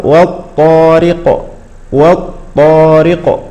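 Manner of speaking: والطارق, slow, the ط not bounced; slow recitation. Shown as a demonstration. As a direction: chant والطارق smoothly. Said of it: "ولا نقول وط". (تلاوة بطيئة والطارق)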